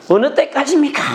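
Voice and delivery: high, acted plea; lamenting. Preacher voicing a psalmist waiting on God, lamenting. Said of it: "막 탄식을 해요".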